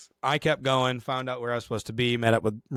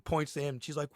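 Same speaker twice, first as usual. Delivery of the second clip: ordinary talk, nothing marked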